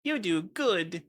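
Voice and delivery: high, goofy put-on voice; mock empty praise. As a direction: parody a nothing-burger compliment. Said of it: "Fucking nothing burger statement is like".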